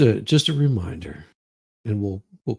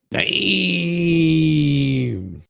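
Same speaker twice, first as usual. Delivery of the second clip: impressed exclamation; 9 semitones higher, 4 dB louder than usual. Real, not acted. Reacting to the booty shake.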